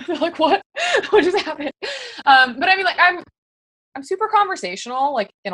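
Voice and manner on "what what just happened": higher, laughing through the question; mock bewildered laughing quote; laughing confusion. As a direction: play the baffled recruit with a laugh. Recruits' confused reaction.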